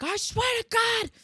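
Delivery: mock fury. Husband on hold with the cable company, high furious outburst.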